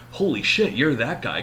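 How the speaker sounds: guard blurting surprise at the famous hostage; shocked exclamation. Retold or imitated. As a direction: gasp it out like you just recognized a fugitive. Security guard shocked recognition.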